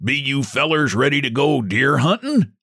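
lightly voiced country guide; backwoods guide. A narrator's reading. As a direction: guide asking the men about hunting.

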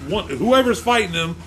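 retold argument, laying down terms; heated standoff. Driver answering a rival at a roadside fight, insistent.

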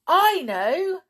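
high, loud bright burst of an idea; the clown suddenly has a plan. Acted. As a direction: blurt it out as it strikes you. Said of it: "said the clown suddenly".

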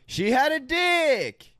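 high, shouted bragging bro voice; mock bro shout. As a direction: brag it out like a dude. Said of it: "shouting a really good thing".

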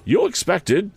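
cold lady of the house looking them over; Miss Havisham. A narrator's reading. Miss Havisham addressing Joe, haughty.

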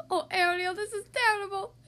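a fussing crab in distress; worried Sebastian. A narrator's reading.

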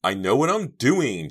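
high, loud, strained insistence; groaned retort. A narrator's reading. Girl brushing off friend's warning, groaning. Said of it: "Jade groaned".

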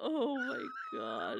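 high, slow wheeze of laughter; real laughing. Real, not acted. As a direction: gasp it out while cracking up. Winded from laughing at story.

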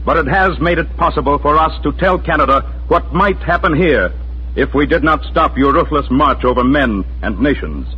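ordinary talk

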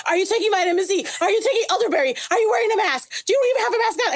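high, rapid-fire worried questions; anxious friend grilling a sick friend over FaceTime, high and panicky. Retold or imitated. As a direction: pepper him with frantic health questions. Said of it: "I was freaking out".

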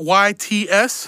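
slow letter-by-letter spelling, Y T S. Shown as a demonstration. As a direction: spell Y T S out slowly like a joke. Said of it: "You guys know how to spell that?".

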